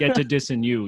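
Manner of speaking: mock rapper, low and repetitive; low-pitched mocking rap cadence; Tim Savo. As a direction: drone through a parody rap verse. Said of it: "such a drama".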